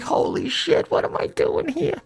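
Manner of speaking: high, softened squeal; thin, high mock bewilderment; retold disbelief. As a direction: act out stunned dismay in falsetto. Retold stunned reaction in high voice.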